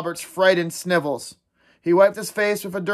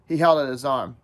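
ordinary delivery

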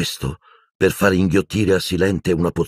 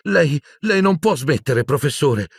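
high, strained, stammering insistence; a boy insists his teacher keep drinking, anxiously; anxious retort; 10 semitones higher than usual. A narrator's reading. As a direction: push back, torn and frightened. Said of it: "ribatté Harry".

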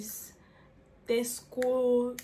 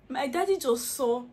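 ordinary delivery

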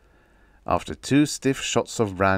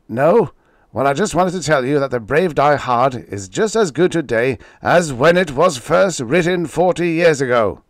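louder, higher, furious and sarcastic; loud angry editor; 7 semitones higher, 7 dB louder than usual. Acted. Editor angrily exposing the writer over the phone. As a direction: tear into him over the phone. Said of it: "Evans's angry voice was loud".

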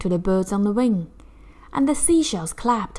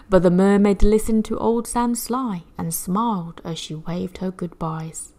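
usual speaking voice

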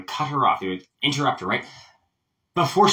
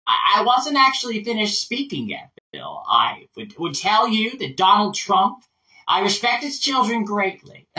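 higher-pitched American politician, holding the floor; Hillary Clinton; 4 semitones higher than usual. Acted. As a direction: pause mid-thought but keep the turn. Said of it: "here's Hillary Clinton".

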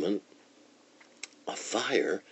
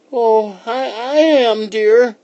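put-on high, loud quavering old lady voice; high-pitched old-woman character voice; 8 dB louder, slower than usual. A narrator's reading.

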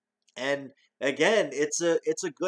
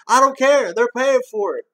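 Axel Foley as inspector; 10 semitones higher, 10 dB louder than usual. Retold or imitated. Reviewer acting out the fake inspector, high and loud. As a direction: play the bossy building inspector, hammed up. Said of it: "Axel Foley, building inspector".

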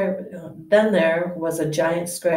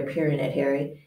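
ordinary delivery, nothing marked

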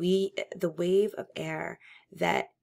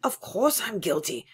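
louder, raised protest; cried out; 5 semitones higher, 5 dB louder than usual. Acted. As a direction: cry it out eagerly. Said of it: "cried Parsons".

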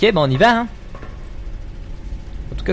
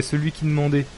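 usual speaking voice

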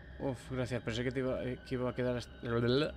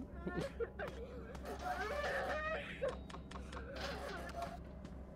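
high-pitched laugh; 11 dB quieter than usual. Real, not acted. Laughs at his own joke.

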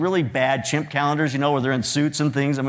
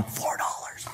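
whispered aside to her husband; 10 dB quieter, slower than usual. Retold or imitated. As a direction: breathe it quietly so the kid won't hear. Wife answers him on the side.

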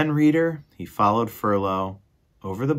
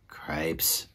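breathy whispered exasperation; whispered curse; 6 semitones lower, 9 dB quieter than usual. Acted. Furlow whispering at his brother's nonsense. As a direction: whisper it under your breath in disbelief.

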